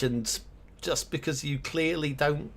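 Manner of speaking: ordinary talk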